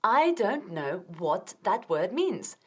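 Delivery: slow, careful model sentence; slow model phrase. Shown as a demonstration. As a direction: say it slowly for students to copy.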